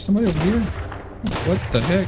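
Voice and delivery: surprised. Real, not acted. Sudden enemy spotted in game.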